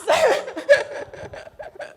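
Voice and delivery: high giggling laugh; bright burst of laughter. Real, not acted. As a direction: break into laughter. Bride laughs mid-speech.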